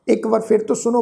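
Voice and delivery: normal talking voice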